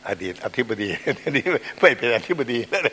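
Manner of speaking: loud, laughing aside. Real, not acted. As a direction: joke through open laughter. Joking before the blessing.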